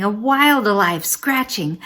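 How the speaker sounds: ordinary delivery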